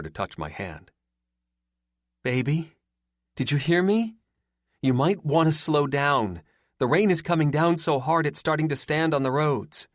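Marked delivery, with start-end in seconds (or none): 2.2-9.9 put-on higher, gently worried girlfriend; raised, anxious plea to the driver; concerned. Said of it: "I could hear the concern in her voice".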